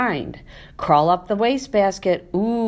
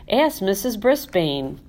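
ordinary delivery

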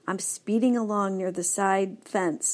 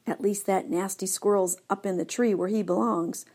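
ordinary delivery

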